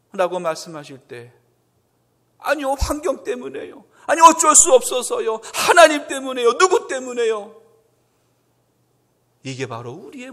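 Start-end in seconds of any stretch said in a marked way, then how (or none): 2.4-7.6 higher, louder defensive excuses; higher defensive protest; making excuses. Acted. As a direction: deflect the blame, whining. Excuses to God, acted.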